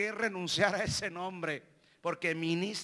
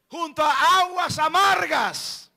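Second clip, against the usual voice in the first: loud, higher preaching cry; preaching shout; 6 semitones higher, 7 dB louder than usual. Acted. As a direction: drive the point home. Clímax de predicación. (grito de predicador)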